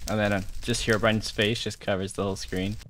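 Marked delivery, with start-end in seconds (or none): none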